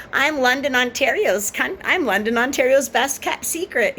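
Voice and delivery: high mocking imitation of a bragging woman. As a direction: sneer it out high as her.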